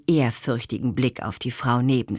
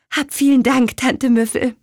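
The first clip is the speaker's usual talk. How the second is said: high, louder heartfelt thank-you; eager thanks; 7 semitones higher, 5 dB louder than usual. A narrator's reading. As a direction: thank her warmly and brightly. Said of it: "sagte Petronella".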